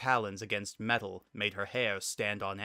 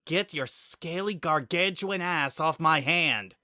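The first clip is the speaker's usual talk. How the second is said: higher, louder and slow, forced through clenched teeth; spitting pain and rage at the reptile pinning her hand; furious hissed outburst; 7 semitones higher, 5 dB louder, slower than usual. A narrator's reading. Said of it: "she hissed through ground teeth".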